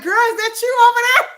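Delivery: high, loud, fast teasing; loud, high amused disbelief; surprised. Real, not acted. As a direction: call her out, half laughing. Teller spotting friend online.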